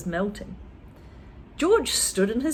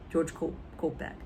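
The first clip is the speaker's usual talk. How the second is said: ordinary delivery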